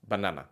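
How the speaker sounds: "banana" with short open ah vowels; Spanish banana. Shown as a demonstration. Teacher repeating the Spanish version for contrast. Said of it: "Do you hear the difference?".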